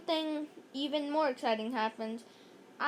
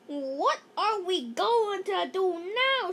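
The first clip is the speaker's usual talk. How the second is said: mild shout; 5 semitones higher, slower than usual. Acted. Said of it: "shouted Neil".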